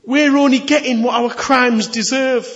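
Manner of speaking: high, loud confession of guilt; a criminal telling his mate they deserve it, loudly; earnest rebuke. Acted. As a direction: insist on it, owning the guilt.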